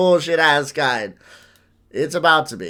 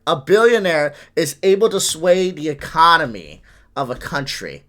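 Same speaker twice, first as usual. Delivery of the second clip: ordinary talk, nothing marked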